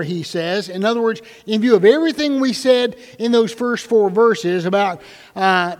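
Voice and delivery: usual speaking voice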